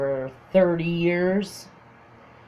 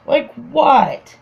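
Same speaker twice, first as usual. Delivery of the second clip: higher, louder mock bafflement; baffled indignation; 6 semitones higher, 5 dB louder than usual. Her reply about how she is dressed, baffled. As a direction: retort in puzzled indignation.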